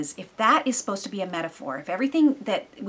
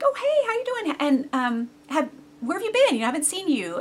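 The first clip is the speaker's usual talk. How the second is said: bright, much higher friendly hello; cheery greeting; 7 semitones higher, 3 dB louder than usual. Retold or imitated. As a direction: greet her like an old friend, sing-song. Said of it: "and I was like".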